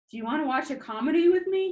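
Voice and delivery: fast, high and loud, blurting it out; hurried eager ask; rushed. Acted. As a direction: get it all out in one nervous breath. Nervous rushed invitation.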